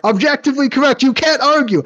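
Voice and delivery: loud, high triumphant boast; gloating shout. Pretend. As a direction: crow it out like a smug winner. Gloating over his ranking.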